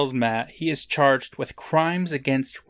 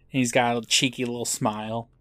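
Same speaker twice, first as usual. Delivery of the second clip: usual speaking voice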